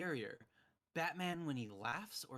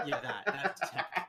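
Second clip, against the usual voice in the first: breathy laughing, louder; 5 semitones higher, 9 dB louder, slower than usual. Real, not acted. Friend repeats the tech joke while laughing. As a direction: laugh through the punchline.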